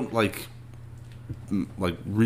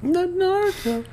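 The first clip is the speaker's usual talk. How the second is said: character voice. Pretend. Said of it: "to get into the character, you have to say".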